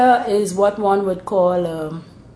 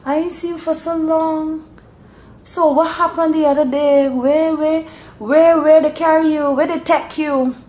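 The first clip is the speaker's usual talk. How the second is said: concerned Bajan dialect; 11 semitones higher than usual. Acted. A Bajan asking after someone long unseen, concerned Bajan dialect. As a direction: fuss over them in broad Bajan. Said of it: "If it's real concern, they would say".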